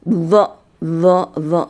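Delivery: zo buzzed with the tongue on the upper teeth; buzzing zo, tongue against the teeth; zo drill. Shown as a demonstration. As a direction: repeat zo with a buzzing z. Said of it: "ujung lidahnya ditaruh di gigi seri atas".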